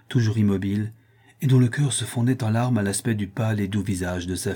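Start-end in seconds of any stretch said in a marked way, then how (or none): none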